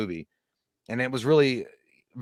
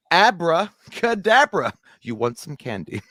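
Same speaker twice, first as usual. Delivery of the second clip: high, slow sing-song dealer voice; hammed-up movie dealer impression; movie character voice; 7 semitones higher, 6 dB louder than usual. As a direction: drawl out the offer like a sleazy candy man. Acting out movie scene voice.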